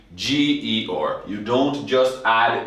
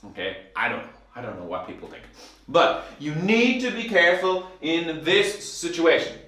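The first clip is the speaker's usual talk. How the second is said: ordinary talk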